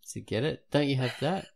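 ordinary talk, nothing marked

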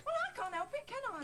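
indignant retort. Acted. Pregnant helper answering the puppeteer's criticism, indignant. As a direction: protest loudly in self-defence. Snapping back at criticism of stomach.

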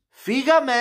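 high, loud launch shout; high-pitched, punchy send-off; excited kickoff. Real, not acted. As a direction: call it out to get things going. Host kicking off the video.

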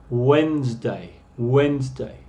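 Wednesday said WENZ-day, silent d and e; Wednesday as two syllables, WENZ-day; correct pronunciation. Shown as a demonstration. Teacher showing the class how Wednesday really sounds. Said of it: "La pronunciación aquí es Wednesday".

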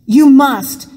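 urgent, loud "You must"; urgent plea. Acted. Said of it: "Joseph repeated".

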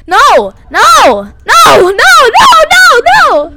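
loud no's climbing into high shrieks; panicked screaming. Real, not acted. Player chased in a horror game, panicked screaming. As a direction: scream it over and over, rising in panic.

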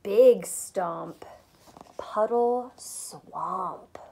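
gentle storytime whisper; whispered read-aloud. A narrator's reading. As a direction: hush it like a secret to kids. Whispered reading to children.

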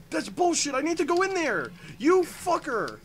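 high, loud furious yell; angry outburst. Real, not acted. Player cursing the game for blocking the way, angry outburst. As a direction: explode in a high-pitched rage.

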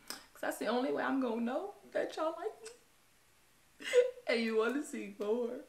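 laughing. Real, not acted. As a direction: laugh through the ask. Laughing while asking for subscribes.

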